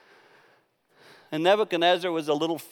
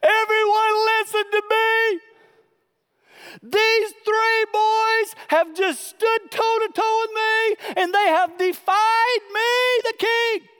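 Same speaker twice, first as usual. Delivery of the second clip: high, loud, slow royal proclamation; hammed-up king's decree; Nebuchadnezzar proclaiming; 5 dB louder than usual. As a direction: declare it to the whole court. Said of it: "He goes".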